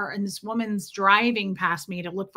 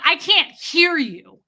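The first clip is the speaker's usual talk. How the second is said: loud, high and drawn out; angry shout; 6 semitones higher, 7 dB louder, slower than usual. Retold or imitated. Client to stylist over phone chatter, angry shout. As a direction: shout it slowly to make a point. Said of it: "I just shouted at you guys".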